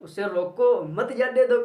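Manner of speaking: "उसे रोको" slow and set apart, then "मत जाने दो"; pause after रोको. Shown as a demonstration. Teacher shows how the listener understood it, pausing after रोको. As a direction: break at the comma after रोको. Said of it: "लेकिन सामने वाला क्या समझ रहा है".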